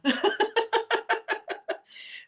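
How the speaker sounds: high burst of unvoiced laughter; big laugh. Real, not acted. Laughing off her own nerves at the camera. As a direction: laugh it off, breathless.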